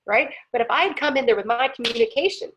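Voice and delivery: usual speaking voice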